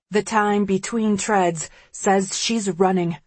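usual speaking voice

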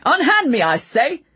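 high, loud outraged cry; indignant shout. Acted. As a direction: shout it at the soldiers. Ratcliffe seized by soldiers.

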